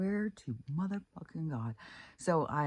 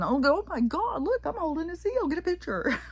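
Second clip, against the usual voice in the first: much higher, squealing with delight; mock excitement; 5 dB louder than usual. Imagined tourist thrilled to hold a seal, squealing high. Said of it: "some of us would have been".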